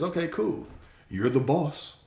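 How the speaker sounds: high-pitched, agreeable yes-man; breezy "whatever you say" voice; easygoing compliance. Acted. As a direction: go along with it, pitched well up. Voices reality agreeing to anything.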